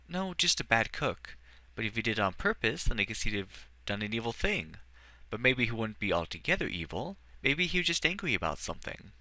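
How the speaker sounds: put-on a shy boy, unsure how he feels; young boy voice. Edward reasoning about evil.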